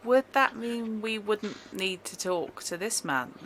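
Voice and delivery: northern English accent, a lightly put-on voice. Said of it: "I've got the northern accent and it won't go away now".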